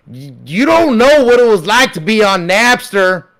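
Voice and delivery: put-on high, loud whiny complaint about the old days; lightly voiced indignant millennial; mocking a millennial. Comic mocks millennials complaining about the old times, whiny. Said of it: "people my age be like".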